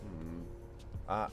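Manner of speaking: usual speaking voice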